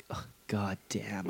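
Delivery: slow, hushed curse to himself; whispered dread. Retold or imitated. Retelling his thought on facing Parisian jail, whispered dread. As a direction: let it out slowly under your breath.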